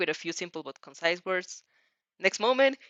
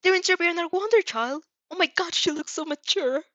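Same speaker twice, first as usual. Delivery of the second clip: high, loud, gushing delight; thrilled mother; 11 semitones higher, 4 dB louder than usual. A narrator's reading. Mother sees her child interviewed on TV, thrilled gushing. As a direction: gush like a proud mom.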